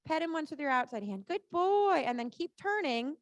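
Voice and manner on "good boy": loud high praise to horse. Real, not acted. Praising the pony.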